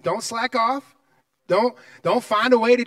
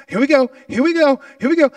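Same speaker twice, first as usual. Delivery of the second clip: louder and higher, pumping himself up; excited build-up; 5 semitones higher, 7 dB louder than usual. Retold or imitated. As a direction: psyche yourself up at the handoff. Reliving taking the baton.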